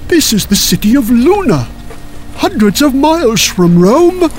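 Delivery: high, slow incredulous questioning; hammed-up, high-pitched clerical bewilderment; baffled bishop. A narrator's reading. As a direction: echo it in rising disbelief, then explain. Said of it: "the bishop gave a surprising answer".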